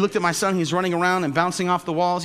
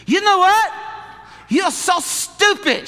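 high, loud, slow scolding; mother berating son; 8 dB louder, much slower than usual. Retold or imitated. Korean mother scolding her son at the table, loud scolding. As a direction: scold the boy in front of everyone. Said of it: "his mom".

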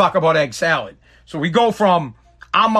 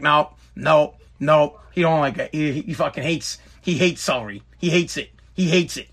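imitating wife; 5 semitones lower than usual. Imitating wife seeing through him.

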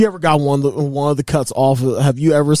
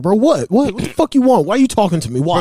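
irritated, higher-pitched rapid-fire questioning; acted testy exasperation; 6 semitones higher than usual. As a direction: snap back at someone pestering you.

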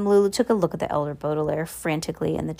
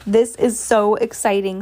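slightly raised, excited squeal; mild squeal; 3 semitones higher, 4 dB louder than usual. A narrator's reading. Reporter watches the plank wobble, squealing mildly. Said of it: "squealed the reporter".

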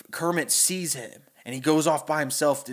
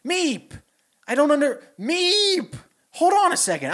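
high, louder alternating frog and meeps; Kermit and Beaker voices; 11 semitones higher, 5 dB louder than usual. Retold or imitated. Kermit puzzling over monster's meeps, high, louder back and forth. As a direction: flip between puzzled Kermit and meeping Beaker. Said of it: "He's like".

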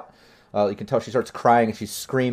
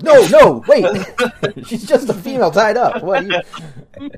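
loud panicked protest; 11 semitones higher, 11 dB louder than usual. Retold or imitated. GM mocks Yasuke's panicked reaction.

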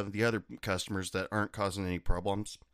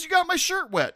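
high, loud whiny accusation; mock angry customer; 6 dB louder than usual. Customer complaining to bartender, high and loud. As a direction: whine like the offended guy.

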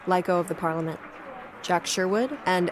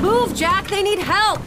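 shouting; 13 semitones higher, 6 dB louder than usual. Acted. Rescue shouts on stormy dock.

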